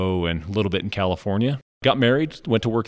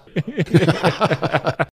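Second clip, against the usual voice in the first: light laugh; 10 semitones higher than usual. Real, not acted. Laughs after joking about mowing grass.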